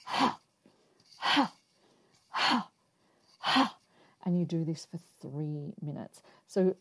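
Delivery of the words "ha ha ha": sharp, louder breath pushed out on each punch; forceful breath out. Shown as a demonstration. Demonstrating the Kundalini fists practice, sharp exhales. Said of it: "you breathe out".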